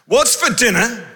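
put-on high child voice; kid asking. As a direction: play the kid again asking. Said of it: "our two young sons going".

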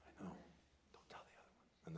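conspiratorial whisper; secret whisper. Retold or imitated. Child whispering back to dad to keep the other sibling from knowing. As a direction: breathe it like a shared secret. Child answers dad's favorite line.

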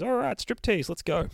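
high-pitched playful rallying call; acting out goofy, eager showmanship; mock hyped-up. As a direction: kick off a silly show with a high shout. Acting out man starting a striptease.

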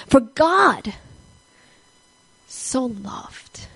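so loved dropped to a whisper; hushed emphasis. Acted. Preacher to congregation, whispering "so loved".

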